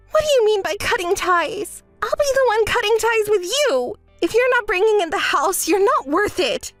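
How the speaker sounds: offended, high-pitched rant; indignant tirade. Acted. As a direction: throw the rejection back angrily. Retaliates at being cut off.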